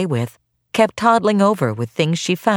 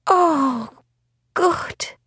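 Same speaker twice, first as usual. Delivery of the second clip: tearful relief; 12 semitones higher than usual. Acted.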